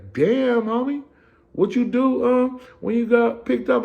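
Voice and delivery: high, loud mocking tease; teasing homie. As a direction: needle him playfully.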